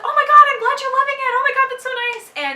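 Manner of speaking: high, loud delighted squeal of words; happy, excited. Retold or imitated. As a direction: gush loudly as a thrilled author. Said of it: "she was so happy".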